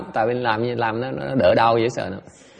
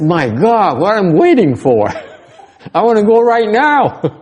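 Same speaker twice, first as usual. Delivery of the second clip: higher, louder, laughing eager plea; eager disciple; 10 semitones higher, 7 dB louder, slower than usual. Retold or imitated. A disciple eager for the Pure Land, laughing excitedly. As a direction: beg to leave right now with a laugh. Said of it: "Janice says".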